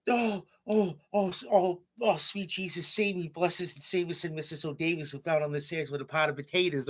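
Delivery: theatrical mock exasperation, easing down as it runs on; mock yelling teacher. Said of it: "she starts yelling at me".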